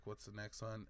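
usual speaking voice

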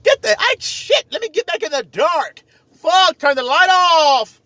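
high, pained protest; family blinded by light. Retold or imitated. Family shielding eyes from the truth, high pained yell. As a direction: cry out against the light, high. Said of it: "What they really saying is".

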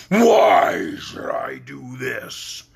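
slow booming beast voice; demanding beast. Acted. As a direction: challenge him like a monster. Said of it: "demanded the beast".

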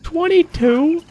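high, slow stunned disbelief; surprised. Real, not acted. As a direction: squeak it out in shock. Disbelief at kill count.

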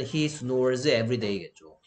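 very slow, deliberate English example; slow model sentence. Shown as a demonstration. Teacher reading an example sentence to students, slowly. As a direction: spell the sentence out word by word.

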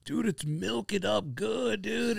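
high, slow, soft stoner drawl; stoner friend. Retold or imitated. Stoner buddy watching the bong fill, slow high drawl. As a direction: draw it out like an awed stoner.

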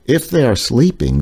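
ordinary talk

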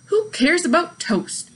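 high, slow indignant grumble; grumbled. A narrator's reading. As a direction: scoff at the toast. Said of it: "grumbled Eugenia".

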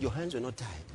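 low, soft, sorrowful rebuke; subdued mock lament; quiet reproach. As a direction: say it low, as if to the dead. Same reproach repeated, now low.

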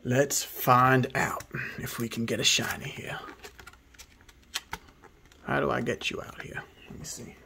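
hushed whisper to the camera. Real, not acted. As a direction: whisper it, full of suspense. Whispering while opening packs.

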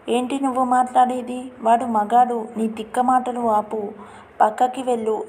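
plain reading voice

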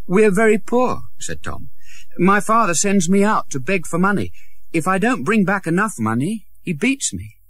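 put-on a poor boy explaining his beatings; beggar boy Tom. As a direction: admit the hardship simply. Tom's voice.

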